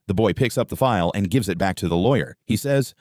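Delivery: usual speaking voice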